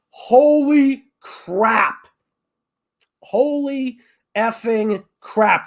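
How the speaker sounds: very slow, drawn-out exclamation of awe; awestruck emphasis. Real, not acted. Fan recalling a brutal wrestling match, slow awestruck emphasis. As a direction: stretch each word out in amazement.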